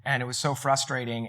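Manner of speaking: normal talking voice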